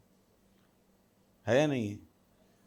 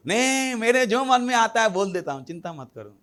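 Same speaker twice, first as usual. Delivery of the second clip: careless blurter; 7 semitones higher than usual. Acted. Careless talker voiced.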